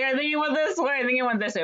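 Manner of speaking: high-pitched, hurried misdirection; mock decoy call. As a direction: imitate someone sending a mob the wrong way. Friend misdirecting the mob with false calls.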